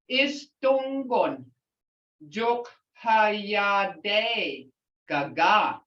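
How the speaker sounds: "Is don gon, juk ha ya de ga ga" said slowly, ending on a falling "ga ga"; slow group greeting. Shown as a demonstration. Teacher slowly saying the group greeting for the class. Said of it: "That's for a group".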